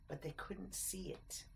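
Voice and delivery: hushed narrator whisper. A narrator's reading. Narrator warning the listener, in a whisper. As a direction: breathe it as a secret warning.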